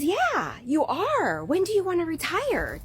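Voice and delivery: breezy phone-clerk reply; a hint of a bright receptionist. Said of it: "she says".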